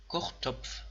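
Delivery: کوختوپف with pf ending; teacher drill; pf demo. Shown as a demonstration. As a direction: say کوختوپف closing on pf. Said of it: "اما اینجا".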